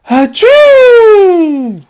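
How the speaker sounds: a loud, high, explosive achoo; big sneeze. Acted. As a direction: blast out a huge cartoon sneeze. Gerald sneezes in story.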